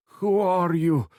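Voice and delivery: high, loud and slow, every word pushed out; a freed hostage demanding answers; fierce demand. A narrator's reading. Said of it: "she demanded".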